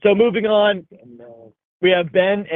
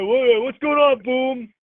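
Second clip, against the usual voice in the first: mock host greeting; 9 semitones higher, slower than usual.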